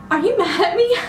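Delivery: high-pitched, playfully guilty question; mock sheepish. Acting out sheepish text after ditching.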